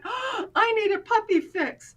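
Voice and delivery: high-pitched gasp of delighted craving; excited longing. Retold or imitated. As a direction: act out melting at the sight of puppies. Retells gasping over the puppies.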